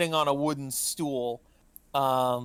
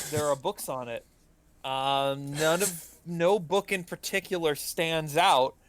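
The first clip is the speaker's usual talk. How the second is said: ordinary delivery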